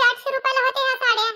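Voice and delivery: gleeful neighbour rubbing it in; gleeful jab. Acted. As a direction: rush the cheap price out with glee. Gleeful put-down about price.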